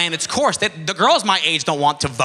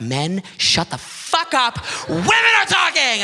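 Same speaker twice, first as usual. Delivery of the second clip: mock TV shout; 7 semitones higher, slower than usual. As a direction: yell it like an outraged TV panelist. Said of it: "You put on The View, it's like".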